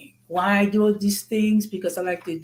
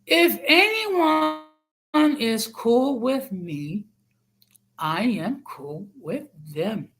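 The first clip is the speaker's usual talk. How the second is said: slow, every word spelled out; over enunciating; 3 semitones higher, slower than usual. Shown as a demonstration. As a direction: say it slowly, like to a child. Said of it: "I'm gonna say it slowly".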